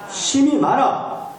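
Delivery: high, very slow proclamation; preaching climax. Real, not acted. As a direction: stretch out the abundance. Climactic scripture reading.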